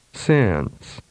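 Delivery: "Since." held long and falling; drawn-out "Since."; long vowel. Shown as a demonstration. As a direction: stretch the i of "Since.". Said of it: "and then with a longer duration".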